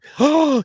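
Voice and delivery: high, loud surprised gasp. Real, not acted. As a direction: gasp sharply in shock. Reacting to Gigi's news.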